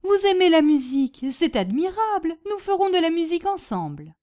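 high, gushing enthusiasm; delighted exclamation. Acted. Hostess planning to play music with her guest, delighted. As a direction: declare your joy, no longer asking.

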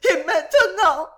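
high, loud theatrical sob; sobbing. A narrator's reading. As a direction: wail it through mock-tragic tears. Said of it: "sanglota madame Pott". (sanglot aigu et théâtral)

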